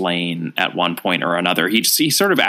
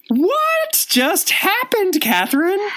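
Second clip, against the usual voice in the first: stunned; slower than usual. Real, not acted. Stunned reaction after the ending.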